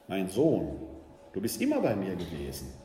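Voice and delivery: gentle father. Acted. Father answers the elder son.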